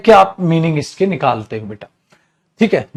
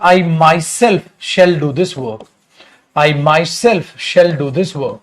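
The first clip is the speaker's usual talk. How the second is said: slow dictation; much slower than usual. Shown as a demonstration. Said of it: "आपने लिखा बेटा".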